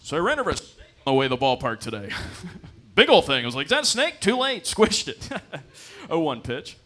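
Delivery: chuckling, high-energy filler between pitches; rambling baseball commentator. Acted. Commentator making up chat to fill the game, chuckling announcer banter. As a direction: ramble excitedly, then toss in the call. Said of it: "they're just making shit up".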